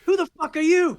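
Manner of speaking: high, outraged chef challenge; high-pitched chef yell; yelling British chef. Acted. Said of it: "some old fucking British chef is yelling".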